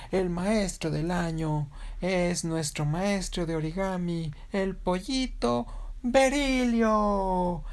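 high, drawn-out stage announcer; winner announcement. Acted. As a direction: stretch the winner's name right out.

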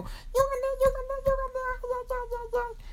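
very high squeaky jubilation; excited little chick. Acted. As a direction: chant it in a tiny bouncing squeak. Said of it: "Berilio estaba dando saltitos y dijo".

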